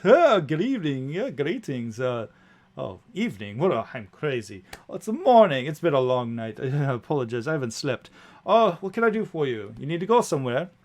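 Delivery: muddled but friendly driver, swooping pitch; chatty coach driver. Acted. Sleepless coach driver greets new passengers, chatty and flustered. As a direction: greet a fare, flustered after a sleepless night.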